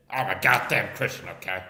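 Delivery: loud, mocking mimicry; Poon. Shown as a demonstration. Comic repeating his impression of a rival, loud and mocking. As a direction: blurt it loudly, dropping off on okay. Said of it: "here's my impression of Poon".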